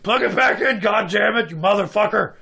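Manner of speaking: mock-furious Sloth holler, high and loud; Brett the janitor. Janitor mixing his curses at prankster kids, high loud yelling. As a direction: curse like an outraged Sloth impression. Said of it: "kinda sounded like Sloth from Goonies".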